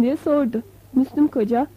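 usual speaking voice

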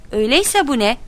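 impatient examiner raising the voice; mild shout. A narrator's reading. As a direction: snap out the question. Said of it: "diye bağırdı".

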